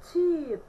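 Cheap with a long stretched ee, said loud and clear; long ee vowel. Shown as a demonstration. Teacher showing students the vowel, long stretched ee. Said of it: "tem o som de I prolongado".

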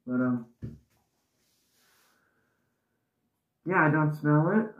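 muffled behind face mask; speaking inside respirator; talking through gas mask. Real, not acted. Seen 4: Full-face gas mask on throughout; reference frames show bare face.

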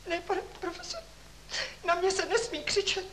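high, whiny, half-laughing put-on panic; faked nervous fit. Pupil to professor, hammed-up high nervous whine.

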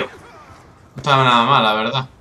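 normal talking voice

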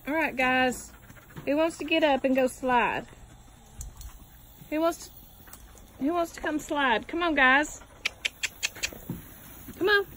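rally the dogs to come slide; calling the dogs. Real, not acted.

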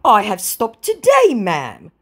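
hammed-up promise; mock scammer voice. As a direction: scammer claiming he stopped. Voicing scammer.

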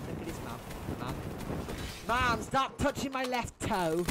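lightly voiced brat teasing a camper; mocking a spoiled gamer. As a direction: whine at mum like a pampered gamer. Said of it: "That's what I picture is going on here".

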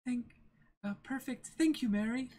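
put-on high, polite girl's voice; young lady Kaya. Kaya thanking her butler, high and polite. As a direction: thank the servant sweetly. Reading Kaya's reply in voice.

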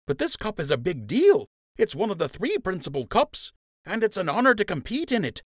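high, earnest coaxing voice; persuasive friend. Acted. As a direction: talk him into competing. Lund persuades Joe.

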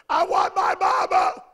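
high, drawn-out shout; a child's desperate cry, loud and stretched; echoing child's wail. Retold or imitated. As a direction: wail it loudly down the hallway for mama. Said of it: "you can hear the echo all down through the hallways".

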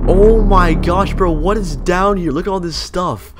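loud, high, drawn-out amazement; amazed. Real, not acted. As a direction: gush slowly at a big discovery. Finds the hidden underground base.

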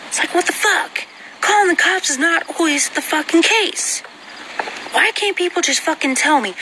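high-pitched, exasperated venting; real exasperated anger. Real, not acted. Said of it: "why I get so fucking mad".

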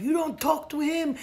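loud, high acted scolding; angry warning. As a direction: forbid it forcefully like an angry relative. Cousin forbids her, acted.

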